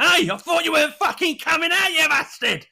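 high, loud mock heckling shout; talking wheelie bin. Bin heckling its owner at night, mock shout. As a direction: yell it high as an indignant bin. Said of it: "I wish wheelie bins could talk".

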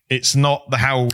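normal talking voice

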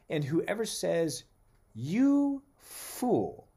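slow, weighty proclamation; scripture reading. Acted. Preacher reading Jesus' words to the congregation, slow proclamation. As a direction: read it like the words carry authority.

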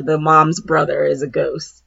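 ordinary delivery

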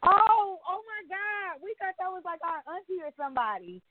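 high, gushing oh my God; excited thanks. Real, not acted. Wife thanks friend for the gift, high and gushing. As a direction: squeal with surprised delight. Thrilled reaction to surprise gift.

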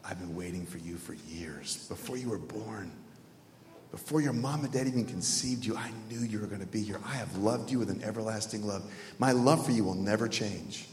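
low, soft, tender voice; the Lord. Acted. God welcoming a man before judgment, low and tender. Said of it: "The Lord says".

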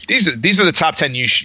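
ordinary talk

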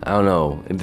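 usual speaking voice

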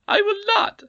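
high, loud squeaky refusal with rising end; stubborn goblin queen. A narrator's reading. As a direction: refuse again defiantly.

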